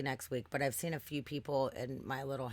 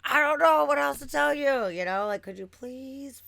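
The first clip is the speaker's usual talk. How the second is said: exasperated plea; 9 semitones higher, 7 dB louder, slower than usual. Retold or imitated. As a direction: beg them with tired disbelief. Pleading with the workers.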